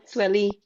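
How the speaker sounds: "Swelly" as sweh-LEE; correcting pronunciation. Retold or imitated. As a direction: correct them with "Swelly", two syllables. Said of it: "And I'm like, No".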